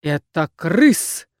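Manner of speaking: put-on slow low-ish old crone bark; barked by old woman. A narrator's reading. Crone startling the pair, slow barking. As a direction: bark it from the shadows. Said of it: "гаркнули совсем рядом".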